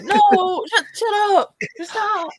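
exasperated outburst. Retold or imitated. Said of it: "I'm like".

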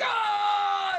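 loud, high bellowed oh; mock roar. Psycho Gorman bellows at the man, hammed-up roar. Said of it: "he's like".

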